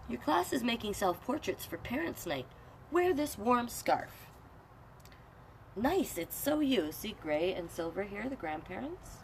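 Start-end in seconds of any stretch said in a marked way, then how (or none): 0.1-6.9 lightly voiced doting grandparent, higher. Grandparents giving Red a scarf, lightly voiced. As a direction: fuss over him sweetly.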